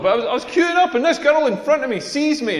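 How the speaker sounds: ordinary talk, nothing marked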